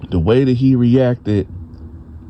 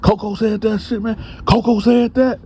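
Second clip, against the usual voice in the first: hollering denial; 10 semitones higher than usual. Retold or imitated. Bone yelps his innocence to Dante, high and frantic. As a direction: play the guilty man putting on a whole show. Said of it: "now you hollering, you hooting".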